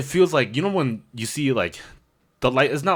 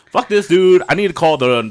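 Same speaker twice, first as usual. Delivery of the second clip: higher, louder fed-up outburst; exasperated; 8 semitones higher, 6 dB louder than usual. Retold or imitated. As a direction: done waiting. Frustrated decision.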